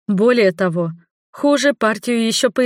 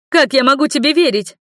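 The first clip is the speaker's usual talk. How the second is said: a raised, higher cry of hurt; shouting; 7 semitones higher, 4 dB louder than usual. A narrator's reading. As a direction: cry out at him, betrayed. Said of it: "Я уже кричала".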